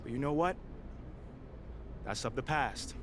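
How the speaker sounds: firm public address, building to passionate peaks; press conference speech. Acted. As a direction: make your case to the reporters, rising as you push. Player speaks at press conference.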